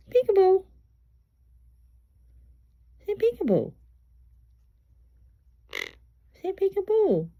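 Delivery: coaxing pet talk. Real, not acted. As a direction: coo and prompt a bird up high. Praising and coaxing the parrot.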